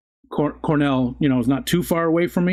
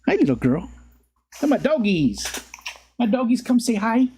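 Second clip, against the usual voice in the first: sing-song call to the pets; 10 semitones higher than usual. Real, not acted. Owner calling his dogs over in high baby talk.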